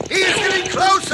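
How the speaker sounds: urgent shout. Acted. Warning that the sheriff approaches.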